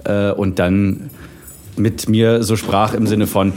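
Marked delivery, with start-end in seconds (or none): none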